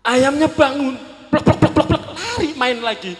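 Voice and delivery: high, loud amazed rush; excited telling. Retold or imitated. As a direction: burst out as the chicken jumps up. Chicken revives, excited telling.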